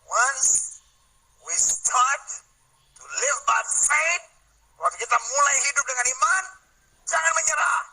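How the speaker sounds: high, rousing pulpit shout; preacher's rallying cry; full-force preaching. Real, not acted.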